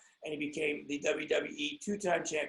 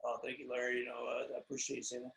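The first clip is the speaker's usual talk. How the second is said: much softer, lower soft-spoken reply; soft-spoken guest; 6 semitones lower, 10 dB quieter, faster than usual. Retold or imitated. Guest thanking the host, much softer and lower. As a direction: answer gently, keep it chill. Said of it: "he's very soft-spoken".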